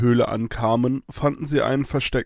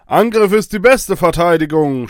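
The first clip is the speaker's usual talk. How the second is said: loud, higher-pitched rallying cry; battle shout; 6 semitones higher, 9 dB louder than usual. A narrator's reading. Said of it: "schrie Baloo". (lauter Kampfschrei)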